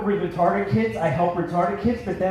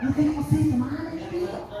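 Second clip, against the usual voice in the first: eager wife; 7 semitones higher than usual. Retold or imitated.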